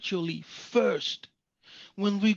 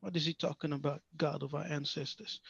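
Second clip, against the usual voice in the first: imitating puzzled listeners; 5 semitones lower, 8 dB quieter, faster than usual. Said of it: "many people's head are turned".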